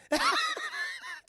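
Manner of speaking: very high, loud giggle burst; shrieking laugh. Real, not acted. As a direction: lose it completely at the scene. Cracks up mid-scene.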